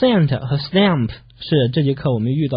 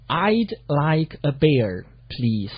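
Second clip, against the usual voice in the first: slow English with spaces between words; slow model reading; 5 dB quieter, much slower than usual. Shown as a demonstration. Teacher models last lesson's sentence, slowly. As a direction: read it out word by word.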